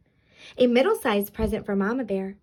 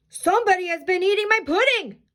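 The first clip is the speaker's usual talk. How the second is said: big bear voice, pitched up and forceful; storybook papa bear's big outcry; great big Papa Bear voice; 8 semitones higher, faster than usual. A narrator's reading. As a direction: boom it out like an indignant big bear. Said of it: "in his great big voice".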